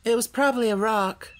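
put-on girlish, casual dismissal; careless. A narrator's reading. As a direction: toss it off lightly as a child. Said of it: "said Dorothy carelessly".

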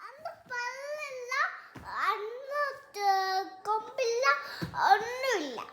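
small, high, very soft crying voice; quiet, upset pleading through tears; real tearful whimper. Real, not acted. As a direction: whimper the words out in halting pieces. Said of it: "എന്തിനാ കരയുന്നേ?".

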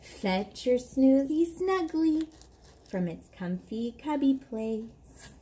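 put-on slow, lilting read-aloud for a toddler; bedtime story reading. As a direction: read it like lulling a child to sleep. Reads bedtime book aloud.

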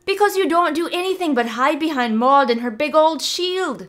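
put-on high, loud teasing girl's voice; cheeky young druid. Mavis mocking the wizard's popularity, high teasing. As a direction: needle the old man playfully. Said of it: "Mavis snorted".

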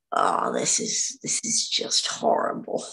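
half-voiced, drawn-out groan of dread; mostly unvoiced, slow aside of dismay; retold dismay. Actress recalling her dread on breakfast TV, retold dismay.

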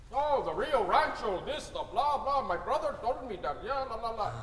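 mocking the customer. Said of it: "you're sitting down".

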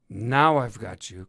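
loud cry on catching someone; triumphant cry. A narrator's reading. Fox cornering the cat thief, crying out. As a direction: cry out as if pouncing on a suspect. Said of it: "cried Jeremy".

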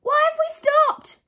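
put-on very high, loud child voice; little boy calling out. Younger brother calls from under the wheel, high shout. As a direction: call up slowly as a small boy. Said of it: "Buddy called out from under the steering wheel".

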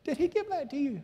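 lightly acted curiosity; asking the girl about the tract; high mock question. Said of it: "I said".

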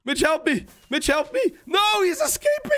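high, urgent cries for help; frantic in-game pleading; frantic plea. Real, not acted. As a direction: call to a teammate in rising panic. Pleading for help as prisoner escapes.

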